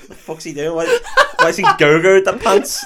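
laughing, bewildered mock outrage; baffled outburst. Retelling the film to a friend, laughing bewildered outburst. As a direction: act out a baffled onlooker, laughing.

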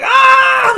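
high shrill scream running off; retold scream. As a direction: let out a loud frantic scream. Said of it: "She runs, like".

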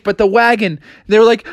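plain reading voice

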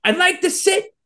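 very high caricatured phone voice; hammed-up high-pitched caller; Ernie. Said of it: "Ernie calls up".

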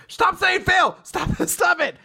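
high, loud protest as a fed-up daughter; hammed-up teenage outburst at a parent; exasperated shout. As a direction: shout it in high-pitched mock outrage. Daughter snaps at dad in story.

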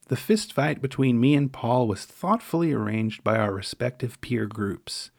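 usual speaking voice

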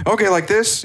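offhand and way up high; high, effortless 'no big deal'; casual stranger. Retold or imitated. Stranger answering the coach, high and offhand. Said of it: "He's like".

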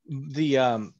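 normal talking voice

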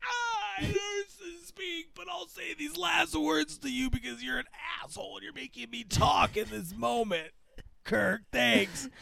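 strained, soft dying croak with wrecked vocal cords; hammed-up agonised death speech; Spock. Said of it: "His voice gets destroyed. Like, it's like his vocal cords are fucked up".

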